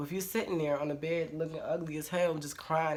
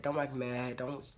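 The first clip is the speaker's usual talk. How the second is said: ordinary delivery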